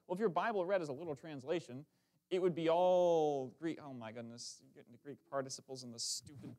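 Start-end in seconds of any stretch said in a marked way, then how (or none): none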